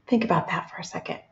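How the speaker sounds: quick whisper to yourself; hushed, rapid inner voice; thinking voice. Shown as a demonstration. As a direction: whisper it fast, like a thought. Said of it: "Use your thinking voice".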